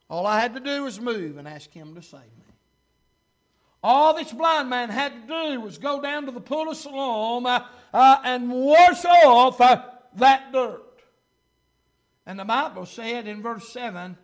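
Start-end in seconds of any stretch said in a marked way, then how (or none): 3.9-10.8 loud, drawn-out pulpit emphasis; preaching. Real, not acted. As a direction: stretch and hammer the key words. Preaches at full force.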